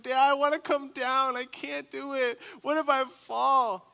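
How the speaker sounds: put-on a terrified six-year-old on the wall; scared plea. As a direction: beg to come down, frightened. Scared kid begging to come down.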